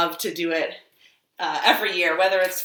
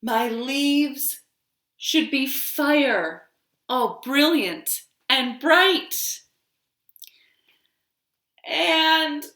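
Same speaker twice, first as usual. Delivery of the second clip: a tree telling its seasons, slow and expressive; tree character; 3 semitones higher, 5 dB louder, much slower than usual. Acted. Librarian reading a picture story to toddlers, storytelling voice. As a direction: read it as the tree, savouring each season.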